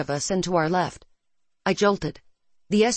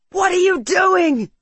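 high, loud, slow panicked scream; 12 semitones higher, 7 dB louder, slower than usual. Acted. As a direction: scream it in disbelief. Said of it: "Marcus screamed".

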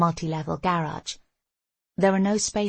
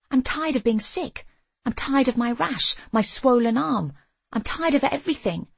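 high-pitched exasperated venting; sick-and-tired complaint, voice pitched up; fed-up outburst; 7 semitones higher, 4 dB louder than usual. A narrator's reading. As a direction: list each grievance with rising frustration. Narrator's fed-up outburst to herself.

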